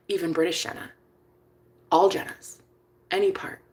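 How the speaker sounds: put-on soft, unhurried boyfriend; quiet promise with pauses; tender reassurance. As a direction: fade gently toward a whisper. Voices boyfriend's gentle promise.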